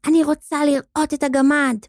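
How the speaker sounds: put-on high-pitched demanding girl; נוצי shouting. A narrator's reading. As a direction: demand it in a high child's cry. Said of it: "צעקה נוצי לילד השמנמן". (צעקה תובענית של ילדה)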